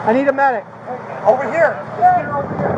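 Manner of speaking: loud urgent call for medic; man down shout. Real, not acted. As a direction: shout for help across the scene. Calling medics for victim.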